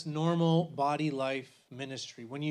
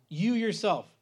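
"You" pressed hard, "yourself" falling; emphatic you; 5 semitones higher, 5 dB louder, slower than usual. Shown as a demonstration. Bible teacher pressing Paul's second person, stressing "You". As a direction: jab the word "You" at the listener. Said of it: "meaning I'm pointing at you".

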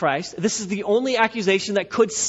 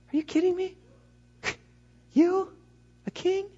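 very high, incredulous scoff with pauses; Pilate's sarcasm; 12 semitones higher, 4 dB quieter than usual. Acted. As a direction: squeak out disbelief, laughing it off. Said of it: "a little sarcasm that was probably in Pilate's voice".

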